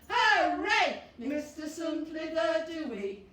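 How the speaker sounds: loud slow chant with shouted hoorays; joyful hooray song; cheerful cheer. Acted. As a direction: cheer it out big for the kids. Cheerful finale verse.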